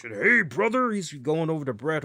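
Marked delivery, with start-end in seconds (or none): 0.0-0.9 high, loud, put-on brash wrestler's greeting; Hulk Hogan. Host imitating a wrestler calling to a rival, in a loud brash voice. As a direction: ham up a brash wrestler's hello. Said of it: "you see the Hulkster".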